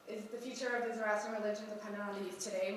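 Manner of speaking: usual speaking voice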